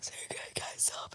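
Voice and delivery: barely audible mock-crying whisper; tearful whisper. Crying on a crowded bus, acted tearful whisper. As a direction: whisper it as if choked up, play-acted. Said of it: "And I started crying again".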